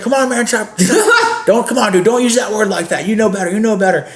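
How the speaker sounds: high voice, laughing as he scolds; Gary scolding. Retold or imitated. Gary scolds the guest for the slur: high laughing voice. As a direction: chide him through laughter. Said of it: "He's like".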